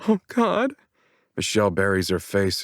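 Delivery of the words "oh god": high, stricken gasp of words; shocked dismay. A narrator's reading. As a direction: let it catch in your throat. Hears mother was beaten.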